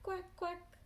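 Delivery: high, short quacks; duck quacking. Acted. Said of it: "the duck or goose going quack".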